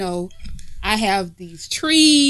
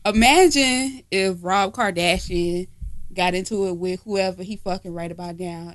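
usual speaking voice

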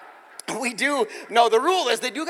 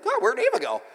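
mild surprise; 13 semitones higher than usual. Retold or imitated. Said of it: "I'm like".

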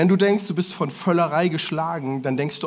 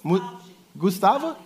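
usual speaking voice

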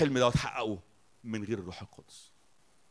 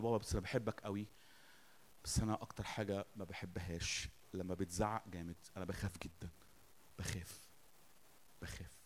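retold softly, a little lower, trailing off; polite, scared child; 5 semitones lower, 12 dB quieter than usual. Son admits fear of father's yelling, hushed and retold. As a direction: gently imitate a son's hushed confession. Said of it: "الواد متربي".

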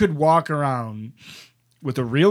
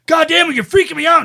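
high, loud, startled angry yell; angry shout; 11 dB louder, faster than usual. Retold or imitated. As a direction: bark it out like a spooked stranger. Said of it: "that guy goes".

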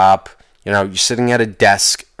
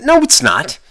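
defiant denial; 11 semitones higher than usual. Real, not acted. Defiant answer to emails.

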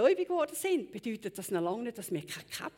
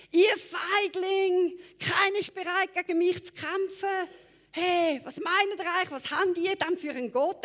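loud, higher taunting giant shouting across the battlefield; Goliath roaring taunts at the Israelites, loud and higher; Goliath bellowing; 4 semitones higher, 6 dB louder than usual. Retold or imitated. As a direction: bellow the insults, dragging the slowest words. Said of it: "übergebrüllt".

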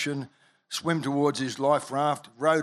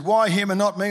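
higher and louder, jealous protest; wife protesting to the preacher, higher and louder; indignant demand; 6 semitones higher, 5 dB louder than usual. Retold or imitated.

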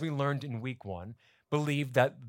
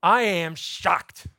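slow, loud, high-pitched disbelief; dramatic reading of a shocked letter; 6 semitones higher, 7 dB louder, much slower than usual. Acted. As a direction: read it out as stunned outrage, each word landing on its own. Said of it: "here's what he writes".

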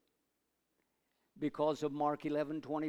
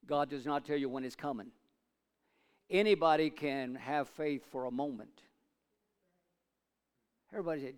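ordinary delivery

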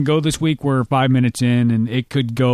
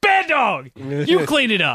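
scolding; 10 semitones higher, slower than usual. Acted. Scolding the dog for vomiting.

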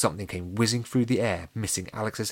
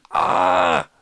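high, loud scream of fright; 11 dB louder than usual. A narrator's reading. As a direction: scream at a ghost. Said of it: "Alex screamed".

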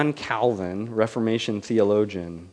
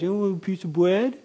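much higher and fast, bread said as bwead; lisping baby talk; 9 semitones higher, 4 dB louder, faster than usual. Shown as a demonstration. God asking a toddler about bread in lisping baby talk. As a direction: coo it quickly to a toddler, no R's. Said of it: "'cause you can't say your R's yet".

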